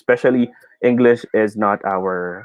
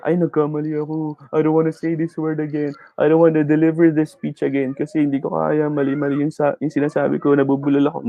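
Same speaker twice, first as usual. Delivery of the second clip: higher, sustained plaintive self-pity; mock discouraged whining; 5 semitones higher than usual. Learner giving up on a speech after mistakes, mock despair. Teacher voices a discouraged speaker.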